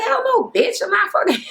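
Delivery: very high squealing outraged no; mock shriek of refusal. Pregnant twin refusing to twerk, very high mock shriek. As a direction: shoot way up into a scandalised squeal. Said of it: "And she like".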